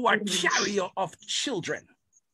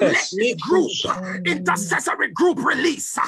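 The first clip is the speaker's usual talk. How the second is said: fiery preaching; 2 semitones lower than usual. Acted. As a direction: preach it with full force. Preaching at full force.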